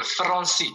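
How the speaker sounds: Français with the R trilled, as in Spanish; rolled Spanish R. Shown as a demonstration. Teacher modelling Kurdish word for a student, rolled R. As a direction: roll the R in Français, ending in "see". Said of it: "on essaye de sortir vraiment le R d'espagnol".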